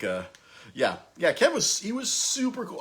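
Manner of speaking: ordinary talk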